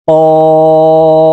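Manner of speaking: কফ chanted with the vowel held four counts; long drawn qaf. Shown as a demonstration. Tajweed teacher chanting কফ for students, long. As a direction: stretch কফ slowly like recitation. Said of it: "কফ চার আলিফ টান হবে".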